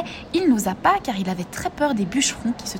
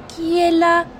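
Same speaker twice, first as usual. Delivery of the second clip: put-on high, loud old granny voice; grandmother; 8 semitones higher, 5 dB louder, slower than usual. A narrator's reading. Sick grandmother calling to the door, high granny voice. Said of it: "dit la grand-mère".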